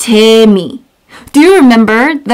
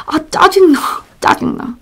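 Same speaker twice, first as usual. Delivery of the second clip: irritated; 7 semitones higher, 4 dB quieter, much faster than usual. Shown as a demonstration. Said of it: "This expression we use a lot".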